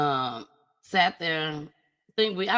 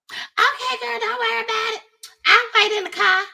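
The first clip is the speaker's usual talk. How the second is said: mimicking the friend, much higher; high, sweet put-on voice of a friend; friend's high voice; 14 semitones higher, 5 dB louder than usual. Friend offering to wait, in a high mock voice. Friend answers in retold quote.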